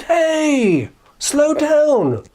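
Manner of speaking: high, drawn-out goat call; calling a halt. Acted. Middle goat halts the troll, high drawn-out call. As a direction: call out high to stop him. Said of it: "stopped him and said".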